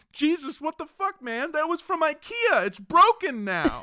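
high, indignant mock complaint; mock outraged shopper. Host voicing a man complaining to Jesus about his table, mock outraged. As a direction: play a guy whose IKEA table got flipped.

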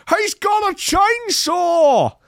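high, slow hammed-up yell; mock panicked yell. Reading out the panicked customers yelling, hammed-up shriek. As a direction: shriek it like a terrified pub customer.

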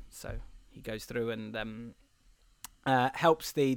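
usual speaking voice

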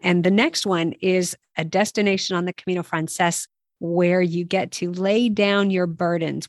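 ordinary delivery

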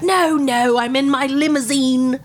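hammed-up slow parody of a singer. Fake singer reassures hosts from the limousine, stretched parody voice. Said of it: "And she's like".

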